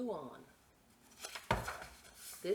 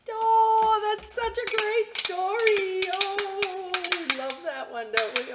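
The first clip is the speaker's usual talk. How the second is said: high, loud delighted gush; 13 semitones higher, 12 dB louder than usual. Acted. Host gushing to children about the story. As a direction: gush over the book.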